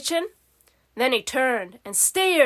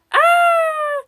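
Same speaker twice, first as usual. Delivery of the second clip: high piercing shriek, louder; ghost's shriek; 4 dB louder than usual. A narrator's reading. Startled ghost shrieks back at the cat, high frightened shriek. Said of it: "shrieked the ghost".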